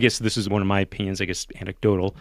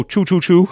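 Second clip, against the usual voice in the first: higher, louder choo choo like a train; 9 semitones higher, 7 dB louder than usual. Retold or imitated. Roommate's joke on taxi, train-like chu chu. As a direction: puff it out like a toy train. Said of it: "Like a train".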